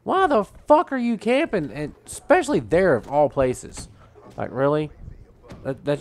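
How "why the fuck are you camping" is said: high, loud incredulous gamer outburst; exasperated disbelief. Retold or imitated. Gamer retelling being killed by a camper, high outraged yell. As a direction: replay the moment the camper got you, pitched way up. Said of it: "I was like".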